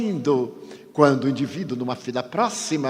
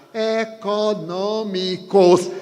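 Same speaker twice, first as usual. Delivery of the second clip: "econômicos" dito devagar, sílaba por sílaba; bem silabada; 6 semitones higher, 3 dB louder, much slower than usual. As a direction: separe cada sílaba de "econômicos". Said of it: "pronunciei a palavra bem silabada". ("e-co-nô-mi-cos" bem silabado e lento)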